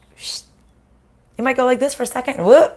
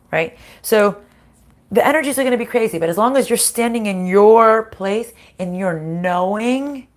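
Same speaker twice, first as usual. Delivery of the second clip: ordinary talk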